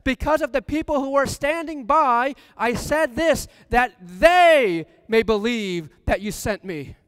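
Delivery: high, loud prayer, ending slow; fervent prayer. Acted. Preacher voicing Jesus praying at the tomb, loud and fervent. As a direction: pray it over the crowd.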